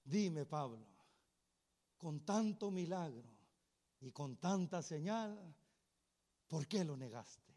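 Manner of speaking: quiet, low and grave asking; low probing question. Acted. Asking Peter why he denied Jesus, low and grave. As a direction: draw the question out softly and gravely.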